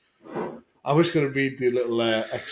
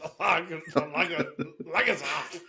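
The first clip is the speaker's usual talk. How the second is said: higher, halting stab at Scottish; attempted Scottish accent; 4 semitones higher, 3 dB quieter than usual. Co-host struggles to copy the line, attempted Scottish accent. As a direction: keep restarting in a poor Scottish accent. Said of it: "in a Scottish accent".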